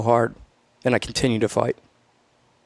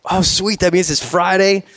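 eager, higher-pitched reaction; retold excitement; 7 semitones higher than usual. Exhausted trainee thinks it's graduation Friday, excited. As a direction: perk up as if let off the hook.

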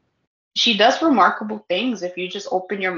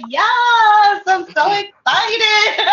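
excited; 10 semitones higher, 5 dB louder, much slower than usual. Retold or imitated. Retelling joy at fans' DMs.

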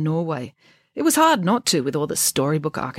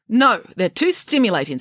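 mildly snappish, higher-pitched refusal; snapping; 6 semitones higher, 4 dB louder than usual. Acted. Head nurse to Friday about reading matter, snapping. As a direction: refuse with a touch of snap. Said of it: "snapped the head nurse".

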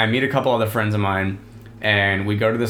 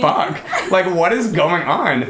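higher, drawn-out bewilderment, half-laughing; slow, high-pitched mock shock; mock disbelief; 7 semitones higher, 3 dB louder than usual. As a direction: imitate baffled friends, stretching the words. Voicing friends' shocked reaction.

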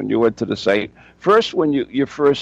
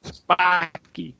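New England accent; 6 semitones higher, 3 dB quieter than usual. Shown as a demonstration. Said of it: "Got the New England accent on it there".

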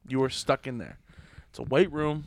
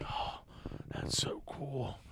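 awed whisper; 11 dB quieter, much slower than usual. Retold or imitated. Retold admiration, soft, slow.

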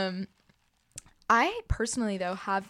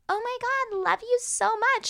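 gushing fan voice; 13 semitones higher than usual. Retold or imitated. Mocking gushing birthday reposter.

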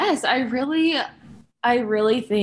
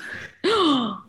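big, high, mocking gasp; audible gasp; 7 semitones higher than usual. Shown as a demonstration. Demonstrating the audible gasp to her co-host, a high mocking gasp. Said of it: "An audible gasp".

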